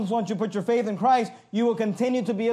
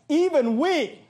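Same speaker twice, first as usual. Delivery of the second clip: raised, mock-important emphasis on both words; higher-pitched sarcasm, voicing the apostle; sarcastic; 6 semitones higher, slower than usual. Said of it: "that's the sarcasm".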